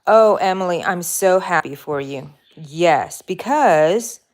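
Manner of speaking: loud happy exclamation; excited. Real, not acted. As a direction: gush with joy for her. Reacting to a viewer's news.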